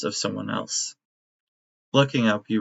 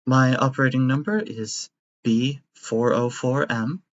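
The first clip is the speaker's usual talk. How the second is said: kindly robot caretaker. Acted. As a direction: play a doting robot nanny.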